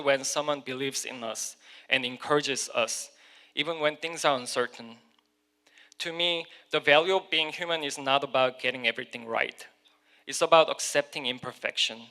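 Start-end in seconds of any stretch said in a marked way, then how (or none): none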